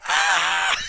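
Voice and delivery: loud, drawn-out mock scream; hammed-up outraged yell; imitated scream. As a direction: scream in exaggerated protest. Imitating wrestler's scream on ejection.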